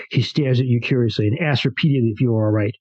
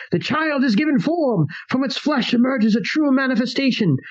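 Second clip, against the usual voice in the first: screaming; 12 semitones higher than usual. Acted. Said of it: "your partner's voice screaming".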